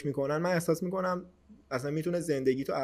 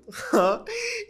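sudden high-pitched laugh; burst of laughter; 4 dB louder than usual. Real, not acted. Laughing while telling a friend about the prayers. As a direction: break into a high giggle.

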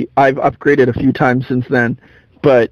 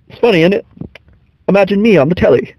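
slightly higher mock British accent; Joker doing British; 4 semitones higher, faster than usual. Retelling the Joker's aside after a killing, mock British accent. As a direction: imitate a random switch into British. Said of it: "randomly does a British accent".

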